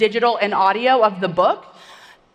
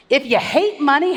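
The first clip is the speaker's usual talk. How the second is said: ordinary delivery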